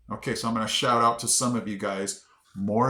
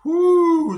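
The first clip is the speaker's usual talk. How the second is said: high, loud drawn-out whoo; long whoop; 10 dB louder than usual. Real, not acted. Facing the last hard town name, high whoop.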